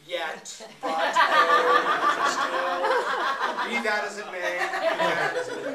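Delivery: laughing parody of a sonnet's volta; mock hedging poet. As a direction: play a poet stalling at the turn. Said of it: "It's like, line nine".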